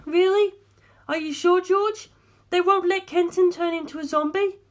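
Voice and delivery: put-on high, pleading young woman's voice; lifted female voice, anxious and imploring. A narrator's reading. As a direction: voice a distraught heiress begging for help. Darlington hopeful questions.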